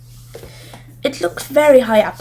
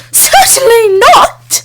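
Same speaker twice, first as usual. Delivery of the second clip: high, loud, slow outraged cry; appalled that anyone would ask; shocked refusal; 12 semitones higher, 9 dB louder, slower than usual. A narrator's reading. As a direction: witch refuses to lend her precious wand. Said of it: "Sharkadder was shocked at the request".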